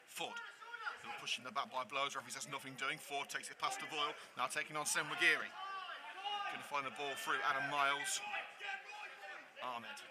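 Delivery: play-by-play. Acted. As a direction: follow the ball calmly, pass by pass. Live play-by-play of the match.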